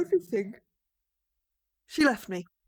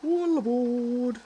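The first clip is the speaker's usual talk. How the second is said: slow, drawn-out conductor's call, louder; bus driver call; 7 dB louder, much slower than usual. Acted. Bus driver calling the girl aboard, slow booming call.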